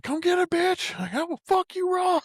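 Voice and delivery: high whooping taunt; mock hollering. Friend quoting Craig whooping at the demon. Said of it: "whooping and hollering".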